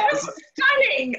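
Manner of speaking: bursts out high and delighted; a gasp of real delight at the shirts. Real, not acted. Host delighted by her friend's embroidery, high excited exclaim.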